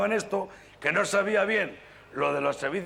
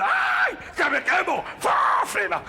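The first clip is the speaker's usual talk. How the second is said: much higher, loud panicked yelp; burning driver yelling; 3 dB louder, slower than usual. Acted. Bus driver feels his back burning, loud panicked yell. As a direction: yelp as the coat burns.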